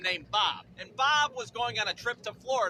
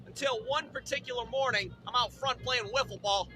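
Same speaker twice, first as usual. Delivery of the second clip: ordinary delivery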